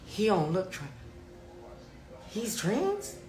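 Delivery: high, soft disbelieving question; acted hushed double-take; incredulous. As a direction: pitch climbing as the surprise sinks in. Acts out disbelief at conference.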